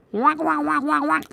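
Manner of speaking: high squeaky quacking; weird duck. Pretend. Drawing a duck, high silly quacks. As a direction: quack like a strange cartoon duck. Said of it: "weird duck".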